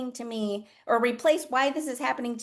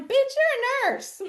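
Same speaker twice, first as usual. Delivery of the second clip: incredulous high exclaim; 5 dB louder than usual. Retold or imitated. To a devastated friend, high and incredulous. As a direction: shoot it up high and loud in disbelief. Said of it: "And I'm like".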